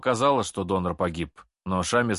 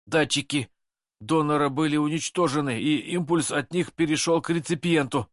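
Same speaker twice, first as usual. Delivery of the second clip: trembling; 6 semitones higher than usual. Acted. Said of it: "дрожа вывалил".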